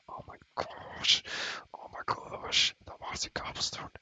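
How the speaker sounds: gushing, forceful stage whisper, drawn out; whisper-squeal in delight, stretching the words; excited whisper. Real, not acted. Gushing over the cute cobblestone.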